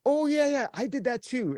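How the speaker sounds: excited interrupting friend; eager interruption. Retold or imitated. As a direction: butt in excitedly, voice leaping up. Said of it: "And I go".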